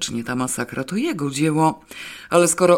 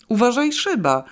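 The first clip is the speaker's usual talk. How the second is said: high sharp warning cry; warning yell about the glass pane; warning shout; 8 semitones higher than usual. A narrator's reading. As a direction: shout to stop him breaking glass. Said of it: "krzyknęła Alicja".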